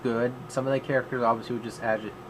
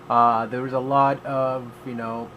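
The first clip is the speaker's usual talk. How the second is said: normal talking voice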